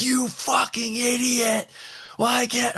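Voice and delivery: high-pitched exasperated mock yell; retelling a late-night outburst at the AI, high mock yell. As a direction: yell at it like it's an idiot. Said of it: "I'm yelling at it".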